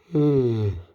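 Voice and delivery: long audible yawn, falling off; sleepy yawn mid-vlog. Real, not acted. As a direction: let out a real tired yawn. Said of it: "Excuse me for yawning".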